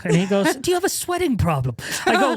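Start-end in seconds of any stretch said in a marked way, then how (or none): 0.6-1.8 high-pitched, appalled imitation; exasperated friend, acted out; mock incredulous. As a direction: ask it with high comic disbelief. Retold friend's appalled question.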